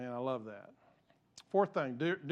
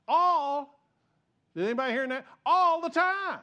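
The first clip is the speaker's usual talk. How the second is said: emphatic preaching; 8 semitones higher, 7 dB louder than usual. Real, not acted. Preacher driving point home.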